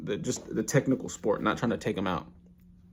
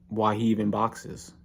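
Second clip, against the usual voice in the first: normal talking voice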